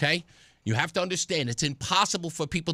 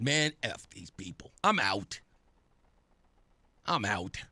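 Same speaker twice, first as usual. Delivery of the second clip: retold disgusted resignation; 11 semitones lower, 6 dB quieter than usual. Retells giving up and leaving.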